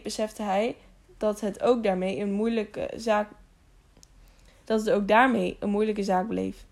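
ordinary delivery, nothing marked

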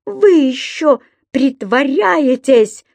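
acted scandalised reproach; indignant. A narrator's reading. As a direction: draw out the accusation indignantly. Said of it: "негодовала госпожа Брюкман".

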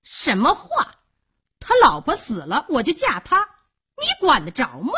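high, brazen woman's comeback; shrewish, high-pitched defiance; defiant retort. Acted. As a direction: throw the challenge straight back. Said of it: "萧娥苍道".

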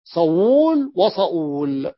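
صول then صؤول drawn out very slowly; teacher spelling out the word pair; slow demo of both forms. Shown as a demonstration. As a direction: sound out صول and صؤول with a clear glottal stop. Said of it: "فتستطيع أن تقول".

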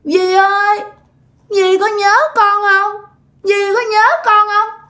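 put-on high, sing-song toddler spirit, tearful; tiny child voice. A narrator's reading. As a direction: plead in a little child's high voice. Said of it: "nói cái giọng nhỏ xíu". (giọng con nít nhỏ xíu, như mếu khóc)

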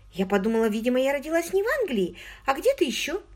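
put-on high, eager girlish voice; an excited young woman confiding. A narrator's reading. Margot voiced by the narrator.

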